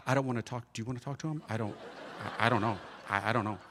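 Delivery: low, soft conferring; imitating hushed, reluctant office workers; murmuring among themselves. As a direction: confer under your breath so he can't hear. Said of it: "they're talking among themselves".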